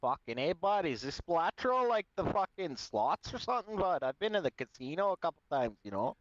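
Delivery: put-on Alberta hoser accent. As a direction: play up a hockey-bro Alberta accent. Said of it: "Alberta accent".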